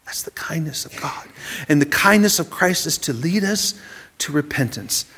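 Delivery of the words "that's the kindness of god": soft unvoiced whisper; whispered awe. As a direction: let it land in a hush. Sermon point hushed to a whisper.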